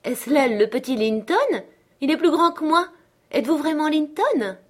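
put-on high, excited girl's amazement; joyful surprise. A narrator's reading. Katy recognising her grown cousin, joyfully amazed. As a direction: marvel aloud, pitched well up. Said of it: "s'illumina d'une surprise joyeuse".

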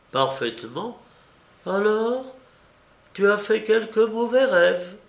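put-on a worried old aunt chiding gently. A narrator's reading. Aunt's voice continues.

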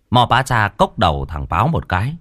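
plain reading voice

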